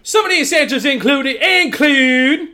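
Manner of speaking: hammed-up Southern announcement; put-on Southern accent.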